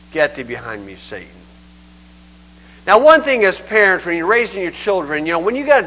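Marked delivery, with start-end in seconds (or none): 0.1-1.3 low, soft, grave rebuke; hushed rebuke. Retold or imitated. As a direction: drop the voice right down to rebuke. Jesus rebuking Peter, acted out.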